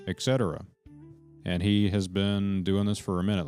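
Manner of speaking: usual speaking voice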